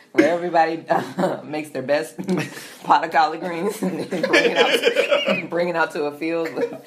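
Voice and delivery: loud, broken up by giggles; laughing while talking. Real, not acted. Host explaining the collard greens festival to co-host, laughing. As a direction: push the words out between laughs.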